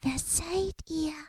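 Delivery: soft, high small voice; shy tiny voice, barely there; whispering. Acted. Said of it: "und flüsterte". (leise, hohe Flüsterstimme)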